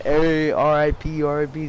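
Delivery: playful ribbing, stretched out loud; teasing a friend. Retold or imitated. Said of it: "just, like, fucking with him".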